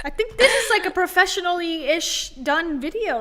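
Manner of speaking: high laugh into words. Real, not acted. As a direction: giggle through it. Laughing at filmmaker line.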